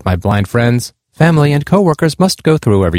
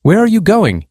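higher, pressing question called out; a narrated shout, only lightly raised; mild shout; 8 semitones higher than usual. A narrator's reading. Running man calls out to Amy, mildly shouting. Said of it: "he shouted at her".

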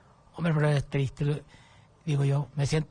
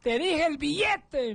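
high, drawn-out mock-angry demand; exasperated imitation, stretching the words out; angry Spaniard, imitated; 9 semitones higher, slower than usual. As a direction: act out an annoyed official, pitched right up. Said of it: "bien enojado".

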